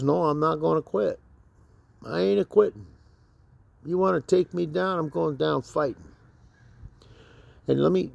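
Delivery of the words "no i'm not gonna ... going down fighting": raised-pitch, stubborn refusal; high, defiant fighting talk. Acted. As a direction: dig in and refuse to back down. Quotes his own defiant refusal to quit.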